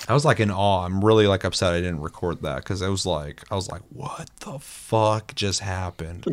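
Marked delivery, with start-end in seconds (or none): 3.9-6.2 slow, drawn-out astonishment; stunned disbelief. Retold or imitated. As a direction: spell out your bewilderment word by word. Recalls being in horrified awe.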